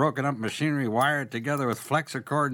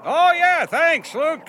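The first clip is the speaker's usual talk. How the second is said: loud, high call back; shouted thanks; 14 semitones higher, 9 dB louder, slower than usual. Acted. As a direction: holler your thanks across the yard. Owen shouting back to Luke.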